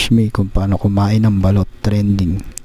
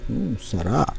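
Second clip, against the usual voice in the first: soft murmured mm of pleasure; quiet savouring; 8 dB quieter than usual. Real, not acted. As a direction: hum it quietly, mouth full. Savouring balut bite.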